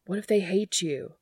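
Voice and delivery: sudden anxious blurt. A narrator's reading. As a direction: let the worry burst out. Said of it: "he blurted suddenly".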